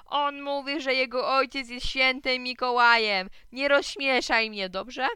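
high, loud jeering announcement; loud, drawn-out mockery; calling out. A narrator's reading. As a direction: broadcast it to the other boys. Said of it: "zawołał Kleofas".

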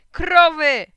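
very loud, high, drawn-out yell; excited shout. A narrator's reading. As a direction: shout the sighting at full voice. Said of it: "krzyknąłem".

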